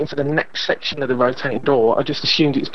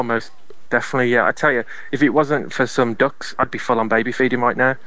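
normal talking voice

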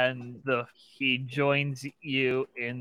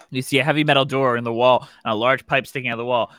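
ordinary talk

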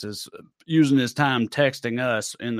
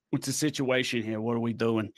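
put-on light, lower New York accent; 4 semitones lower, 7 dB quieter than usual. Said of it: "switching into New York already".

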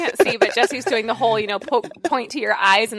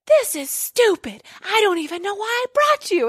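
whisper yelling; 11 semitones higher than usual. Retold or imitated. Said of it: "like, whisper yelling".

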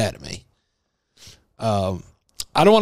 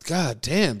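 ordinary talk, nothing marked

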